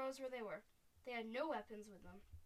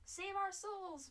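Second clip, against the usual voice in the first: high, loud, drawn-out cry; slow storybook wail, pitch way up; panicked bellow; 8 semitones higher, 5 dB louder, much slower than usual. A narrator's reading. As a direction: bellow for help as a cowardly giant. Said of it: "bellowed the Flesh Lampeter".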